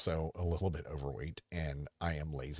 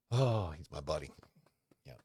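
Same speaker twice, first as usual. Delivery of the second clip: ordinary delivery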